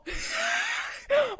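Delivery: high-pitched burst of laughter. Real, not acted. Podcaster reacting to Sam being afraid of clowns, laughing.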